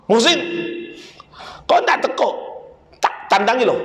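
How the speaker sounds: high, sharp call to a disobedient son; a father's mock angry summons. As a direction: snap at the boy who won't come. Angry father calls son again.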